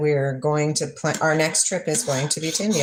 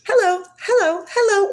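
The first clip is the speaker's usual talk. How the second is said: pushy extrovert; 5 dB louder than usual. Pretend. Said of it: "Are you like".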